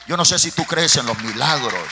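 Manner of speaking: loud, fervent preaching shout. Real, not acted. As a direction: belt it out to the congregation.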